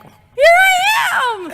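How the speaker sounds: slow, high, loud exclamation; excited shout. Real, not acted. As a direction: belt it out with glee. Excited self-mocking exclamation.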